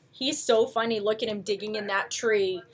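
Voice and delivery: plain reading voice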